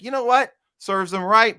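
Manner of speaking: mock the gloating onlookers; callous retort. Mimicking a gloating onlooker, high and smug.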